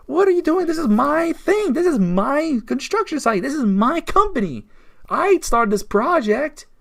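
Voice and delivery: high-pitched mock protest, stressing each claim; indignant manager. Ousted manager protests the takeover of his company, mock outrage. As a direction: imitate an outraged boss pleading ownership. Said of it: "the manager's watching, like".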